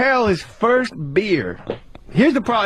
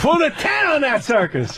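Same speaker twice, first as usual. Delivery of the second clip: mock sportscaster call; 7 semitones higher than usual. Quoting a sportscaster catchphrase, excited.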